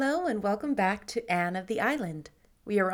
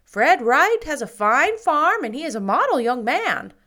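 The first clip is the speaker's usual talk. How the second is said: put-on much louder, higher, opinionated old neighbor; gossiping matron; 6 semitones higher, 10 dB louder than usual. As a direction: hold forth like the village busybody. Mrs Lynde continues.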